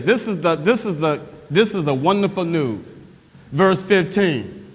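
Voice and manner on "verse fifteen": ordinary talk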